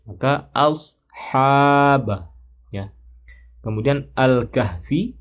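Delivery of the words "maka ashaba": "ashaba" with the ha held for two beats; two-beat madd. Shown as a demonstration. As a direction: stretch the ha of "ashaba" two counts. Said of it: "Dua harokat ya". ("ashaba" dengan mad dua harakat)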